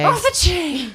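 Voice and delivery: high, hammed-up slang; playful hype echo. Echoing a friend's remark about her hair, playful high hype.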